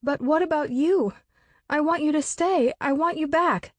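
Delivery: put-on high, begging like a small child; small child's tearful begging; childlike pleading. A narrator's reading.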